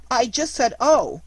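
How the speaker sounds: high, jumpy little voice; nervous. A narrator's reading. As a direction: blurt it out nervously, pitched high. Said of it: "said Piglet nervously".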